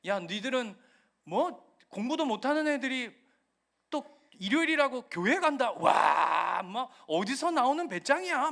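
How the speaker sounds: high, loud jeering classmate taunt; mocking classmates. Classmates jeering at a churchgoing student, high and loud. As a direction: sneer at him for going to church. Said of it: "막 조롱하는 거죠".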